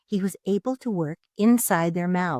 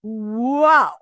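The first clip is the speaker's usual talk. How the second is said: louder startled gasped wow; gasping; 5 semitones higher, 8 dB louder than usual. A narrator's reading. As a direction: react to the awful breath. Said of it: "he gasped".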